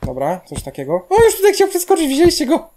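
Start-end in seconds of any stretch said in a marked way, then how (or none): 1.1-2.7 high, loud burst of surprise; startled exclamation. Real, not acted. Player pointing out a jumper to viewers, startled high cry. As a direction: cry out as if caught off guard. Sudden alarm at character jumping.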